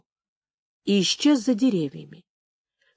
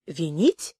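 low, quieter, rising question of disbelief; surprised question; 5 semitones lower, 5 dB quieter than usual. A narrator's reading. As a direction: echo the word low, with surprise lifting the end. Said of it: "удивился Вереск". (удивлённый вопрос низким голосом)

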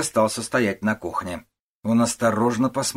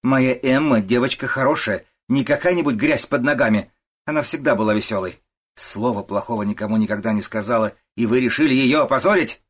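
loud, raised, accusing; indignant defence; 4 semitones higher, 6 dB louder than usual. A narrator's reading. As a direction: defend your daughter and accuse the suitor. Mother indignantly defends her daughter. (возмущённо, с обвинением)

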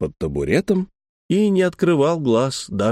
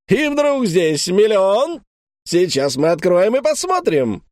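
booming circus ringmaster; 9 semitones higher than usual. A narrator's reading. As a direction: ham it up for the audience over the roar. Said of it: "стараясь перекричать рёв".

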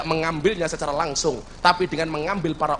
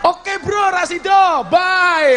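high, loud mock send-off; played-up shouted bail-out; hasty acted farewell; 11 semitones higher, 7 dB louder, much slower than usual. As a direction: call out a loud, high-pitched goodbye. Acts out angel backing off.

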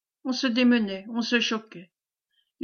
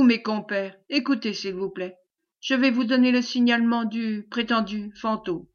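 ordinary delivery, nothing marked

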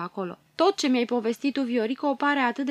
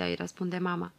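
plain reading voice

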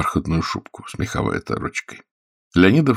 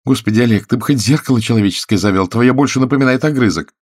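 higher, louder, exasperated woman's voice; nagging actress; 6 semitones higher, 5 dB louder than usual. A narrator's reading. Actress grumbles to Oleg about his mirror, nagging. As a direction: play the fed-up lover scolding.

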